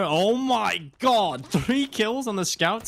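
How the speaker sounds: rising high, slow and drawn-out over the triple kill; excited cast. Real, not acted. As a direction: let the hype lift your pitch way up. Caster reacting to triple kill.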